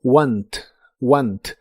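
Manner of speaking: "want" said as written, with a clear final T; "want" read just as it is spelt; careful pronunciation. Shown as a demonstration. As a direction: spell out "want" as it looks, ending on a crisp T. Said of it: "Se pronuncia tal y como se escribe".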